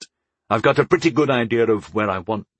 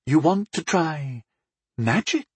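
skeptical; 6 semitones higher than usual. A narrator's reading. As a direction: draw out the disbelief, pitched up. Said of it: "she asked skeptically".

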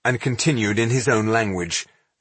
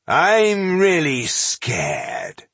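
high, very drawn-out sarcasm; mock fear; 9 semitones higher, much slower than usual. A narrator's reading. Dwarf mocks the warnings, mock-scared. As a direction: stretch it out in pretend fright. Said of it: "Ginsgar smiled and gave himself a little shake".

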